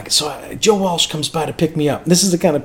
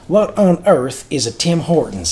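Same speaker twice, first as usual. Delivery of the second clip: Memphis voice; 2 semitones higher than usual. Retold or imitated. Singer to driver about Tim Hortons, imitated Memphis accent. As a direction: imitate a puzzled Memphis Southerner. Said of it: "in his Memphis voice".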